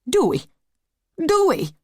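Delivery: high, loud pained yelps; yelping. Acted. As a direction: yelp it out in pain. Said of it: "until he yelped".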